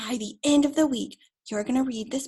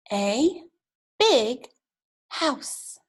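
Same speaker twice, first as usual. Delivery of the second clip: word by word; much slower than usual. Shown as a demonstration. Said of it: "see if you can do it with me".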